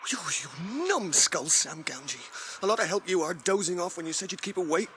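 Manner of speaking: normal talking voice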